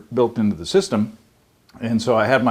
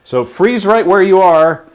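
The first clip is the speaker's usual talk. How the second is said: excited; 8 semitones higher, 8 dB louder than usual. Real, not acted. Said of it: "now we're gonna do some fancy editing".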